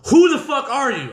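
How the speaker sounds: high, loud agitated demand. Retold or imitated. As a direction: demand an answer from the stranger. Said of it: "now I'm getting agitated".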